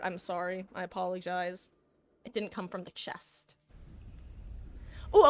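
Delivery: usual speaking voice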